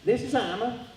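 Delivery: put-on a frail high little old lady. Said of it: "this little old lady answered".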